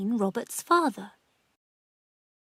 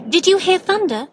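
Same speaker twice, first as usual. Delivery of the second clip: higher, louder shouted question; higher, louder niece calling about thunder; 10 semitones higher, 8 dB louder than usual. A narrator's reading. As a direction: call the question across the yard. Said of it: "she called".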